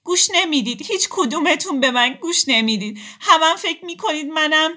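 high, loud tearful outburst; crying out. Acted. Akhtar bursts into tears that nobody listens to her, high and loud. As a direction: wail it through tears. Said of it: "اختر زد زیر گریه".

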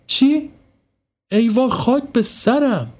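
a mother panicking over her son; alarmed. Acted. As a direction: gasp out the alarm. Mother hears son in basement.

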